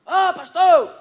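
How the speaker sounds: high call of Ô, pastor; calling out again. Retold or imitated. Stranger calling the pastor on the train. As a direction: call for attention. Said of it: "começou a gritar alguém".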